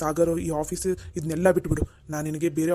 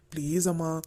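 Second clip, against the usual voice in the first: ordinary talk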